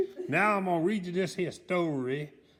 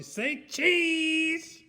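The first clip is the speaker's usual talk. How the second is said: high, loud say cheese; high shouted photo call; 11 semitones higher, 3 dB louder than usual. A narrator's reading. Mister Noisy taking a photo.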